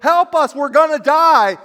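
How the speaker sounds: high, loud panicked cry for help; mock panicked cry. Disciples crying to Jesus, loud mock panic. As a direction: cry out like you're sinking.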